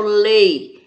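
"lei" said firmly and held long; isolated stressed syllable. Shown as a demonstration. Teacher naming the tonic syllable for class, "lei" stressed. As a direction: put full weight on a long "lei". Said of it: "que eu falo com mais intensidade".

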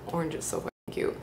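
breathy under-the-breath gush; whispered aside. Real, not acted. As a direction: whisper it to yourself, fast. Aside spotting glasses.

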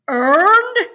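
high, very loud incredulous bark; explosive rising outburst. A narrator's reading. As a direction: shout the word as an outraged question. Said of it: "the warden barks".

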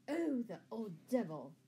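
plain reading voice

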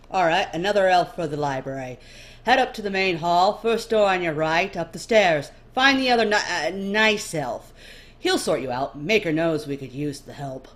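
loud, hurried orders; loud brusque recruiter. Acted. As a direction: bark directions at a newcomer. Busy recruiter calling orders.

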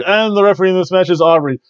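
ordinary talk, nothing marked

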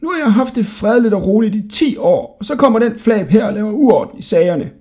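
put-on high, indignant old jailer; furious snorting. A narrator's reading. Jailer fed up with the cheeky boy, high indignant rant. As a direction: rant in outrage. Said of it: "Han fnøs rasende".

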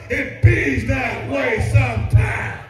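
slow, drawn-out folksy saying; quoting Mama's saying. Retold or imitated. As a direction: stretch out Mama's words for effect. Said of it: "it's like Mama says".

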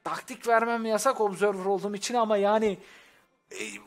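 usual speaking voice

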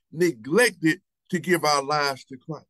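neglected stretched slow and firm; stress on neglected. Shown as a demonstration. Preacher repeating the key word, slow heavy stress. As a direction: hit neglected again, slowly. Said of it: "and I emphasize that word".

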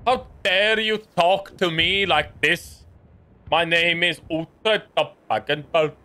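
put-on slow, pompous version of the actor's odd accent; mock Uhtred accent. Streamer mocking Uhtred actor's accent for chat, slow and pompous. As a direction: lightly overplay a TV warlord's strange accent. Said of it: "he comes with the accent like this".

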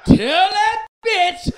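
very high, loud, slow order; shrill demand. Retold or imitated. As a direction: scream the order at him, laughing. Woman's jealous demand voiced.

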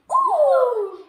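high, loud cry of delight; delighted ooh. A narrator's reading. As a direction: shout it out in delight. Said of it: "For they all cried".

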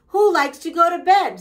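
high, loud, mock-eager question; rally the kids with false excitement; playful rallying question. A narrator's reading. Grumpy's playful rallying question to children.

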